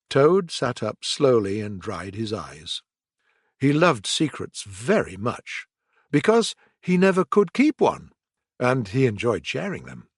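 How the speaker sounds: ordinary delivery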